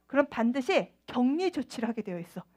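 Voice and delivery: normal talking voice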